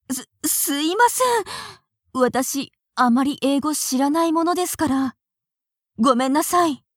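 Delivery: ordinary delivery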